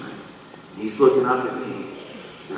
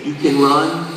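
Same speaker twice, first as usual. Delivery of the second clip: insistent God voice; 4 semitones higher, 6 dB louder than usual. Retold or imitated. Voicing God's insistent message.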